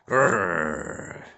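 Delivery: dog growl. A narrator's reading. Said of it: "Yuri growled".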